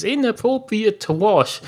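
high-pitched questioning echo; mock-puzzled comeback, voice jumping up; puzzled retort. [name] questions Mary's objection.

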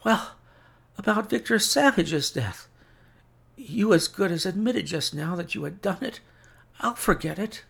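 high, strained invalid voice; pleading sick man. A narrator's reading. Sick Holmes begging for a cure, high strained plea. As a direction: promise desperately, pitched well up.

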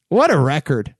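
ordinary talk, nothing marked

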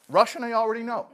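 high, loud triumphant punchline; old man's punchline. Acted.

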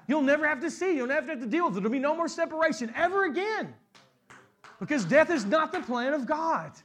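loud, high, building sermon climax; full-force preaching. Acted. Pastor tells the congregation death will end, full-force preaching. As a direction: preach it out to the whole church.

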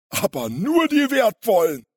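sly, high, loud crook voice; gloating villain. A narrator's reading. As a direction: add it with sneering malice, pitched high. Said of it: "fügte der Große hämisch hinzu". (hämisch und verschlagen)

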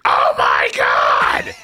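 slow, low, loud mock shout; imitated shriek. Wife spotting the snake, mock loud shout. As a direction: belt out her panic as a mock yell. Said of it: "That's exactly how it goes".

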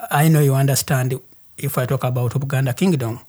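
ordinary delivery

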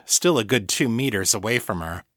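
ordinary delivery, nothing marked

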